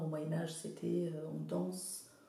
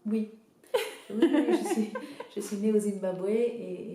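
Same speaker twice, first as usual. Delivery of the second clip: a real laugh in the voice, a bit louder; 3 semitones higher, 8 dB louder than usual. Real, not acted. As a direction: answer through a happy laugh. Laughing answer about her childhood.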